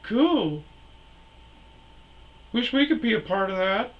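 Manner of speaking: high, loud imitation of the girl; high girly voice. Quoting the girl again.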